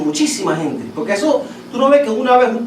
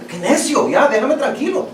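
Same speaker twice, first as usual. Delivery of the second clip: a lightly voiced whiny, stubborn kid, pitched higher; mock stubborn child; 6 semitones higher than usual. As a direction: play a cranky child brushing someone off. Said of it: "un niño así mal". (imitando a un niño necio y malcriado)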